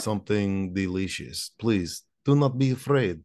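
put-on comrade host with a thick Russian accent. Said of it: "My, my Russian accent".

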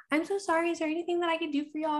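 high, sugary server concern; lightly voiced waitress fussing over a crying mom; sweet waitress voice. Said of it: "the waitress even came and was like".

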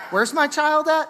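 put-on high, alarmed call for a lost kid; suddenly frantic, looking around; panicked parent. Parent lost child at mall.

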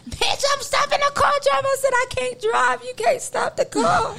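high-pitched, put-on wail of protest; mocking quarrel impression. Retelling the fight in the car to friends, high mocking impression. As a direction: mimic her worked up, voice way up high.

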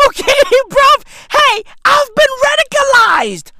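very high, loud mock-hysterical shout; hammed-up screaming. Comedian declaring himself radicalized, hammed-up scream. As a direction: scream the confession at the top of your range.

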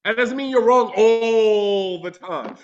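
usual speaking voice

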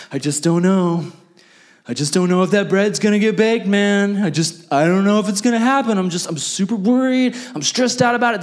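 hammed-up worrywart wringing his hands; mock anxious fretting. Worried customer pleading with baker.

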